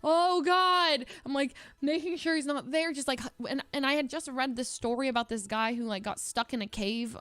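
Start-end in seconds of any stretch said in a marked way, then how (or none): 0.0-1.0 loud, high, drawn-out dread; acted cry of horror; retold panic. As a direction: wail it in mock alarm. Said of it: "I was literally like".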